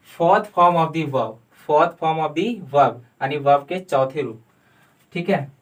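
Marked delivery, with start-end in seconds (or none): 0.1-3.0 very slow, deliberate; dictation pace. Shown as a demonstration. Teacher dictating a term slowly. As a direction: say it word by word, twice. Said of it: "V four".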